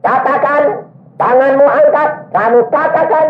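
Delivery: fiery exhortation. Retold or imitated. Preacher urging the young man to believe, in a high ringing voice. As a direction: preach it at him like a command. Preacher quoting his instruction to pray.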